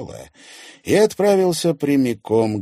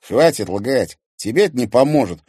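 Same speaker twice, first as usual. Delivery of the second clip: loud, irritated grumble; raised-voice annoyance; grumbling; 5 semitones higher, 8 dB louder than usual. A narrator's reading. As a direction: cut off the lie with a grumble. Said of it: "буркнул Тимофей".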